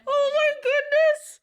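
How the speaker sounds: high, loud and slow, laughing; overwhelmed with delight, half laughing. Real, not acted. Streamer greeting her idol's raid, loud and laughing.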